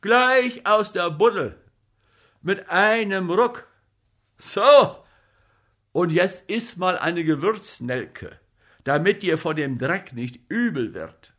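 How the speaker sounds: loud, high urging as he doses a patient; hearty commanding helper; bossy comic actor. A narrator's reading.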